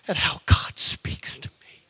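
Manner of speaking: slow, hushed whisper; awed whisper. Retold or imitated. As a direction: breathe it out in wonder. Telling wife, awed.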